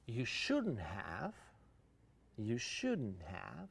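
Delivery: "you shouldn't have" stretched slowly, rising on "shouldn't"; slow, drawn-out shouldn't have. Shown as a demonstration. Teacher models a polite, drawn-out thank-you phrase. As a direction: draw it out gently, rising on "shouldn't". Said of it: "usually you say it when you want to criticize".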